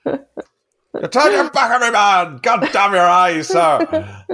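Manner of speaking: high, loud mock captain's rant ending in a laugh; salty sailor shouting. Quoting the captain's rant to a friend, hammed-up yelling. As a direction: overplay a furious ship's captain. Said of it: "incredible salty sailor talk".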